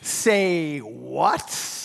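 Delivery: slow, low, stunned double take; mock shocked Mary, slowed right down; incredulous Mary. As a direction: drag it out in disbelief. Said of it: "to which Mary probably thought".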